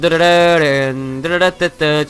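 rolled-r trumpet fanfare sung out; playful sung drumroll fanfare; trilled mock fanfare. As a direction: trill the fanfare with rolling r's. Mock fanfare after reveal.

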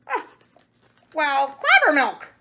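loud, high exclamation; exclaiming. A narrator's reading. The cow spots something in the story, loud exclaiming.

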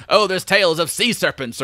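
mock old sea dog pirate accent, played for cheese; cheesy pirate accent. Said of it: "in this really cheesy pirate accent".

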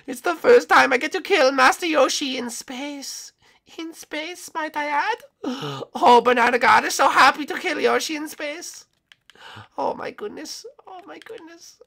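very high put-on villain voice; giddy high-pitched killer cartoon; gleeful hunter character. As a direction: gloat excitedly in a high voice. Plays the hunting character.

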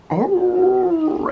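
high, drawn-out hound howl; imitated dog howl. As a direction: answer back as a howling beagle. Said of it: "I mean, howls".

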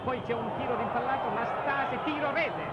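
rising to an excited goal call, pitch climbing; breathless italian goal commentary. Real, not acted. Goal scored, live commentary. (telecronaca del gol sempre più concitata)

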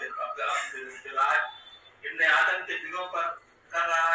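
emphatic, projected mushaira recital; poem recitation. Acted. Poet reciting verses for the web's anniversary, emphatic poem recitation. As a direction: declaim each verse with punch, in measured phrases.

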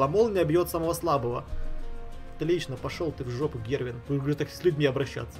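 usual speaking voice

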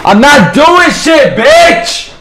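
high, loud, drawn-out taunt; trash-talk shout. Real, not acted. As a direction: scream the insult back at him. Trash talk with enemy player.

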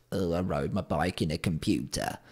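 mocking indoor cyclist impression; mocking Zwift riders. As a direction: put on a soft voice to mock a braggart. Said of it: "people fucking posting up millions of Zwift".

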